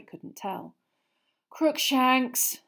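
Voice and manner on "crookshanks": moaning. Acted. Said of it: "Hermione moaned".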